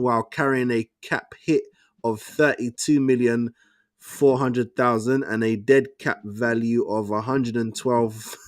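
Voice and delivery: ordinary delivery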